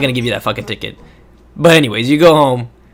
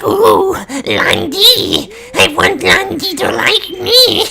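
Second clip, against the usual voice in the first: very high, slow squealing creep voice; hammed-up creepy fanboy squeal ending in a cackle; creepy guy; much slower than usual. Creepy watcher pining for Lan Di, squealing creep voice. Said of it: "some fucking creepy guy".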